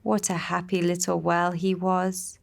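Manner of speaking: plain reading voice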